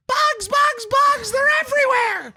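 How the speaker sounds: mock panic. Boyfriend mimicking girlfriend panicking about imaginary bugs, high shriek. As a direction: squeal it like she sees bugs everywhere. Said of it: "she's like".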